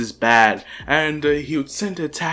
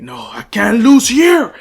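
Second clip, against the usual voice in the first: slow, loud, high battle cry; determined shout; 7 semitones higher, 8 dB louder than usual. Retold or imitated. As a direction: shout it out, stretched and defiant. Izuku's desperate battle cry retold.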